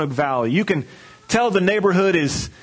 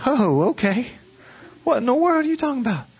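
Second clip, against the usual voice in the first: put-on incredulous kid; 8 semitones higher than usual. Young [name] to dad.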